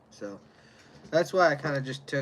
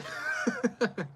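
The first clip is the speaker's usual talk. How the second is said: high laugh; 10 semitones higher than usual. Real, not acted. Laughs at Russia police joke.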